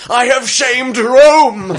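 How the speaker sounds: high, slow, drawn-out screaming despair; screamed line. Retold or imitated. Retelling a racing game character's overlapped cry, high slow scream. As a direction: wail it like a disgraced centurion. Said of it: "who basically just screams".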